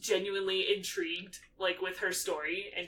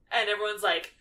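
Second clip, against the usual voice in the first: usual speaking voice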